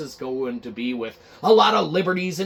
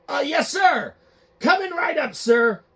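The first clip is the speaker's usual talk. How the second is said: nervous employee; 10 semitones higher than usual. Acted. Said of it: "Guy's like".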